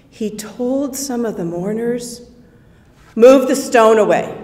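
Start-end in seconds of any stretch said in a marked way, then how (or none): 3.2-4.3 firm, loud, quick order; commanding. Acted. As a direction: command the mourners to move it. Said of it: "in a commanding voice".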